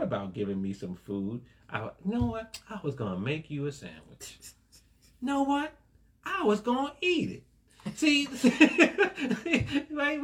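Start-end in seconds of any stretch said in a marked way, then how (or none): 1.7-7.4 high, louder put-on voice of a flaky helper; mock excuse-maker. Preacher mocking a believer's empty intentions, high and louder. Mock-voicing a lazy well-wisher. 8.0-10.2 breaking into high, loud laughter; laughing. Real, not acted. Preacher cracking up at his sandwich joke, laughing.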